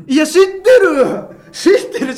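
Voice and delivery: loud, laughing exasperated cry; laughing shout. Retold or imitated. Son laughing loudly at his mom's obvious news. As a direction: burst out laughing in disbelief.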